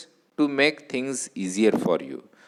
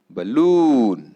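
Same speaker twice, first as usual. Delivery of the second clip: "balloon" with a loud, stretched, falling LOON; stress on second syllable; 6 dB louder than usual. Shown as a demonstration. Teacher presenting fifth word, "balloon" stressed on LOON. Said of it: "on the second syllable".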